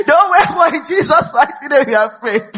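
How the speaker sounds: high, full-force mock exhortation; Pastor Jerry. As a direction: shout it as a preacher goading people to pray. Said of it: "Pastor Jerry won't say".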